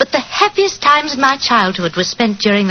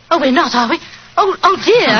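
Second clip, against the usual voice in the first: flustered; 7 semitones higher than usual. Acted. Flustered at being on air.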